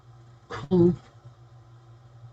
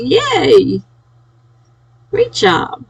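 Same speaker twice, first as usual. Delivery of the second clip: excited; 13 semitones higher, 4 dB louder, much slower than usual. Real, not acted. Cheering a friend's word count.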